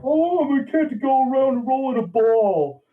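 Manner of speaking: high mock whine of the complaining kids; high mocking kid's whine.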